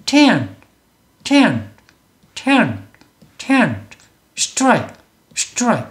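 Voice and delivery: tent and strike each one short falling syllable; one-syllable English. Shown as a demonstration. Teacher shows how it must sound, single-syllable English. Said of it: "이렇게 소리를 내야 된다는".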